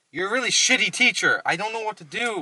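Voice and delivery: high-pitched exasperated complaint; frustrated outburst. Acted. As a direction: pitch jumps up as you blame the teacher. Stuck learner blames his teacher.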